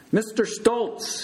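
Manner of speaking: slow, high-pitched shout. A narrator's reading. Big Bird leans down to hidden patient, shouting. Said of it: "he shouted through the sheet".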